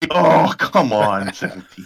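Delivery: exasperated. Real, not acted. Bad dice roll, groaning.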